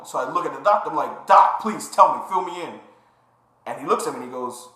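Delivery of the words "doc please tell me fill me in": higher, louder plea that eases off; retold pleading. Patient begging the doctor to fill him in, retold pleading. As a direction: act out an urgent appeal for answers.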